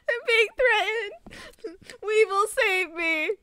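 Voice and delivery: high, drawn-out wail through giggles; laughing mock plea. Real, not acted. Streamer begging Weevil for help, laughing wail. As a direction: cry for rescue, slowly, cracking up.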